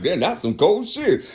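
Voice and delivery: amused laughing line; laughing remark. Retold or imitated. God laughing at the cold trick. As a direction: chuckle it out. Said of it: "He was like".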